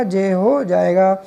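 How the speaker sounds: patient teacher drilling; slow emphatic answer. Shown as a demonstration. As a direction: slowly spell out the answer, leaning on हो. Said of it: "क्या हो जाएगा?".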